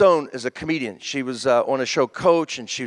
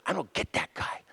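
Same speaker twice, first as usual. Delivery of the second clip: quiet, breath-only retelling of a complaint; hushed complaint; 3 semitones higher, 11 dB quieter than usual. Retold or imitated. Girlfriend privately complains about the houseguest, hushed. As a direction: confide it under the breath, imitating a fed-up partner.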